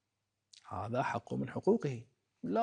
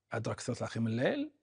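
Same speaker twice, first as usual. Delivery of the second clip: أدرك الثلث الأخير من الليل rattled off fast with a rise; quick echo; much faster than usual. Real, not acted. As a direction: rush the repeat for emphasis. Phrase repeated faster.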